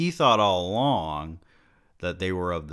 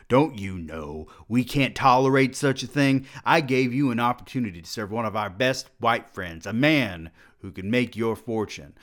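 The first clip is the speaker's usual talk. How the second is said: angry berating; 5 semitones higher, 5 dB louder than usual. Acted. President berating the expelled student.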